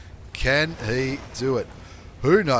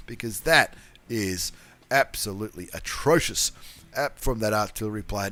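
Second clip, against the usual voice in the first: usual speaking voice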